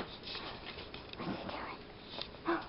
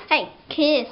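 ordinary talk, nothing marked

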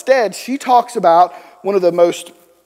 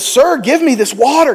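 eager, pitched higher, a bit louder; excited plea; 8 semitones higher, 3 dB louder than usual. Acted. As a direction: beg for the water with sudden excitement. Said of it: "Can you see her excitement?".

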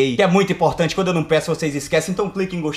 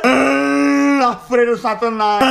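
slow, loud wailing shriek; drawn-out scream; 5 semitones higher, 3 dB louder, much slower than usual. Acted. Narrator announcing the demon nun's attack in a slow wailing shriek. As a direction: stretch it into a howling scream. Demon nun suddenly appears.

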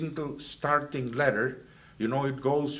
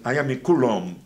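imitating the professor, louder, booming it out; professor's loud proclamation; 9 dB louder than usual. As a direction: declare it grandly to the class. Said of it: "was saying".

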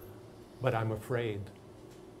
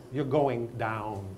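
slow, measured warning at usual volume; drawn-out warning; slower than usual. Acted. Teacher warning non-readers in the class, slow and drawn out. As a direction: stretch each word out slowly.

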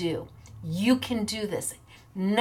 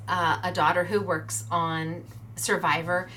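ordinary talk

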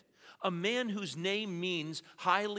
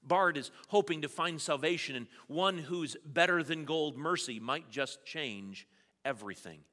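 usual speaking voice

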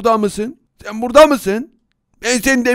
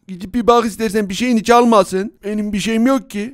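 ordinary talk, nothing marked

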